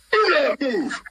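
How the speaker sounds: high, firm command; a colonel pinning a junior officer in place; lightly voiced barked order; stern order. Colonel ordering lieutenant to stay.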